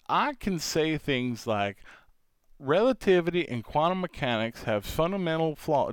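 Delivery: put-on Southern accent with swooping pitch. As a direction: explain physics in a hammed-up Southern accent. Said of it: "I started talking in a Southern accent".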